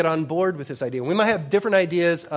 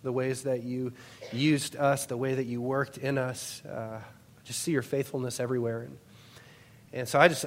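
prayer; 3 semitones lower, 9 dB quieter than usual. Real, not acted. Pastor opening the new year in prayer, hushed and reverent. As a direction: pray quietly and humbly to the Lord.

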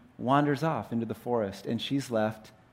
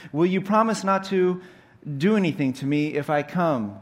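put-on higher, louder worried girl; anxious Jill; 5 semitones higher, 4 dB louder than usual. Jill asks the lion to promise safety, anxious. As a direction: beg for reassurance in a lifted voice. Voices fearful Jill bargaining.

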